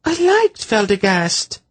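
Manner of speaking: put-on high girlish near-wail of loss; almost wail it, sorry to lose him. A narrator's reading. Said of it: "she almost wailed".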